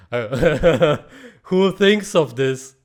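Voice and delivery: loud laughing disbelief. Real, not acted. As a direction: laugh through the question, slow. Laughing at the video.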